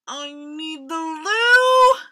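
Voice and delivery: loud, slow, urgent squeak; squeaking crocodile. A narrator's reading. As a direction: squeak out a desperate plea. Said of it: "squeaked Crocodile".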